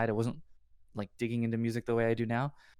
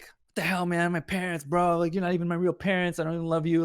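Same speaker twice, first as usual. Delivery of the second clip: higher-pitched, lightly acted teenage outburst; a hint of bratty defiance, retold; mock rebellious kid; 5 semitones higher than usual. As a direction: imitate a kid mouthing off at the parents. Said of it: "I'm just being an idiot, like, kid".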